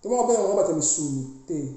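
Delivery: ordinary delivery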